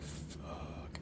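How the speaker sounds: barely voiced curse; whispered curse. Harry spotting the massive troll, whispered curse. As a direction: breathe the curse so the troll won't hear.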